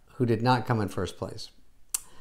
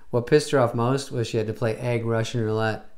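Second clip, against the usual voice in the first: ordinary talk, nothing marked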